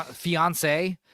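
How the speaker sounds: ordinary talk, nothing marked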